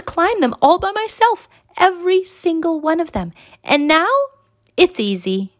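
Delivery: put-on high-pitched girl telling her own story; high, lively child character voice, settling on the last words; eager young pupil. A narrator's reading. Pupil tells master how she learned climbing, high and eager.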